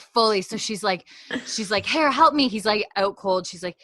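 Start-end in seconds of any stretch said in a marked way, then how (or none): 1.8-2.5 urgent, take-charge order, high and loud; mock-urgent command; Claire. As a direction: imitate a nurse demanding help. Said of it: "Nurse Claire mode activated".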